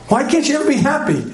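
quick, high-pitched mock exasperation; exasperated husband. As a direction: complain as a fed-up husband, hammed-up. Voices exasperated husband to wife.

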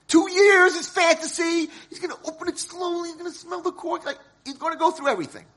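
rising, thrilled, high and loud, then rushing; excited fantasy. Acted. An excited man fantasizing about opening his wine. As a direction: savor the dream out loud. Dreaming of the perfect bottle.

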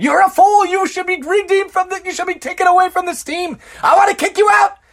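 high, loud furious shout; angry yell. Acted. Angry boss shouting at an employee, furious yell. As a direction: blow up at him over the project.

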